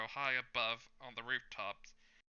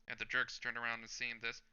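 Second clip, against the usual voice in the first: plain reading voice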